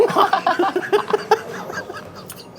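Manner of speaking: high unvoiced burst of laughter; laughing hard at his own story; loud high laugh. Real, not acted.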